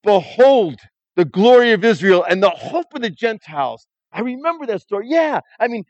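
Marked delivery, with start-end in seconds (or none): 0.0-3.9 loud, slow prophetic proclamation; a loud, drawn-out old prophet's blessing; solemn declaration. Acted. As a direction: lift the baby up and proclaim it. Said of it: "he declared".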